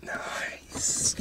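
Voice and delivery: breathy little whisper. Acted. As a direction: whisper it to yourself. Whispered then said aloud.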